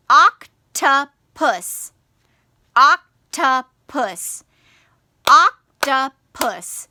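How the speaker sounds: octopus in three slow, loud, separated beats; syllable by syllable. Shown as a demonstration. Teacher counts syllables for children, octopus syllable by syllable. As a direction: clap out oc-to-pus with a gap after each syllable. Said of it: "practice clapping your syllables".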